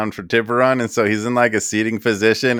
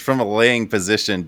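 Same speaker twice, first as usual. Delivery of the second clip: ordinary delivery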